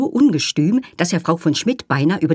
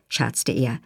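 usual speaking voice

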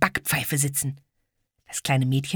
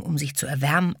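ordinary delivery, nothing marked

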